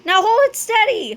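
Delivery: high, tense command; urgent yell. A narrator's reading. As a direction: call out the order excitedly. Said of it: "yelled Fluffy".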